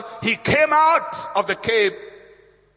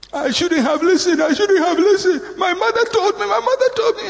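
high-pitched hammed-up lament, climbing; regretful wailing; 11 semitones higher, 3 dB louder than usual. Wife regretting she ignored her mother, wailing complaint. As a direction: wail it higher with each repeat. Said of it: "if you start talking that way".

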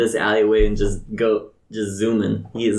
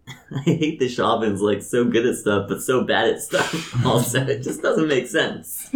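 normal talking voice

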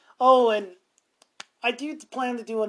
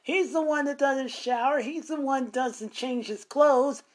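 indignant protest; 6 semitones higher than usual. Retold or imitated. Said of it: "I said".

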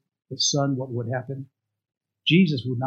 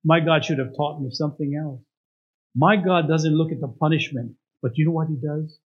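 normal talking voice

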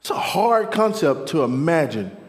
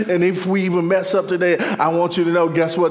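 preaching, building; 2 semitones higher, 4 dB louder than usual. Real, not acted. Preacher promises the congregation new mercy each day, building fervently.

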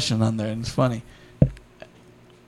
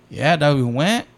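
ordinary delivery

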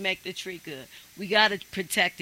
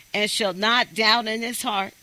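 ordinary talk

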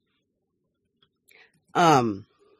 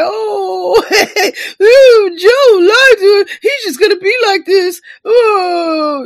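much higher and much louder, mock sobbing and wailing; hysterical boyfriend; 14 semitones higher, 8 dB louder, slower than usual. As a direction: ham up a hysterical jilted boyfriend, crying out. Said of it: "you sound like a fucking hysterical boyfriend".

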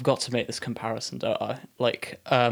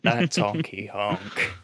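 silly clown; slower than usual. Retold or imitated. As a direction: ham up the silly hook slowly. Mimicking the silly song hook.

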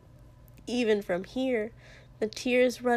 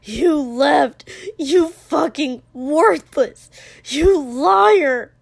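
very loud, raised-pitch insults; enraged yelling; 5 semitones higher, 10 dB louder, much slower than usual. Acted. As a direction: hurl each accusation at full volume. Hurling insults during the brawl.

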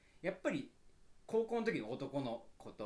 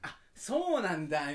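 fake politeness; 4 semitones higher, 10 dB louder, slower than usual. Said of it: "みたいな".

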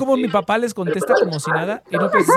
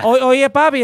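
loud, higher mock Colombian accent; 6 semitones higher, 10 dB louder, slower than usual. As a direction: belt out a caricature Colombian accent. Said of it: "Es que el acento colombiano".

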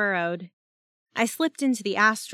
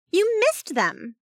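high, slow, louder accusation; accusing; 11 semitones higher, 5 dB louder, slower than usual. A narrator's reading. Jazz confronting her teammate over the lost pickpocket, accusing. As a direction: throw the blame, drawing it out high. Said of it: "she accused".

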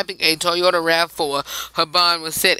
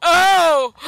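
surprised; 10 semitones higher, 5 dB louder than usual. Real, not acted. Cracking up at the quote.

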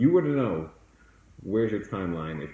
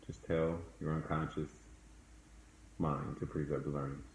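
soft spa whisper; 2 semitones lower, 5 dB quieter than usual. Acted. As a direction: lead them calmly into the past. Leads a guided meditation.

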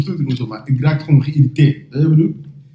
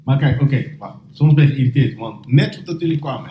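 ordinary delivery

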